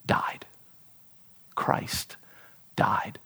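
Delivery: slow, breathy whisper of a grave word; solemn whispered reveal; hushed whisper. Acted. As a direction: let it drop to a whisper. Dramatic reveal of last word.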